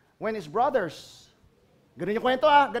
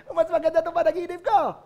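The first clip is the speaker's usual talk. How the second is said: higher, louder, laughing boast; boastful Joseph; 6 semitones higher, 5 dB louder, faster than usual. Acted. Joseph bragging to his brothers about his dream. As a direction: brag with a laugh.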